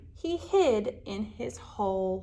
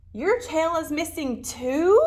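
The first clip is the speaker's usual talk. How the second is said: crying out; 5 semitones higher, 4 dB louder than usual. Acted. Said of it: "cried little lizard".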